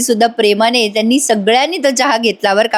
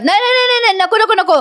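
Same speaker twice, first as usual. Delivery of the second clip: high-pitched retold yell of no no; mock group shout; 11 semitones higher than usual. Brothers stopping Avani from tasting the tea, high mock shout. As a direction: cry out no in mock alarm, much higher. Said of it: "हे सगळेजण ओरडतात".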